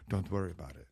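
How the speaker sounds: soft, low attempt at an unplaceable accent; quiet brush-off in a botched accent; Johnny Ive. Johnny Ive waves off the keyboard problem, hushed, botched accent. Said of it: "I don't know what accent that was".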